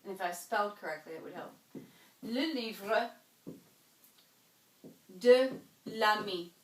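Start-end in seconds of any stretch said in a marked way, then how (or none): 2.2-6.5 "Le livre de l'ami" slowly, "de" and "l'ami" said apart; French pronunciation. Shown as a demonstration. Teacher showing students the elided form. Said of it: "this is my friend's book".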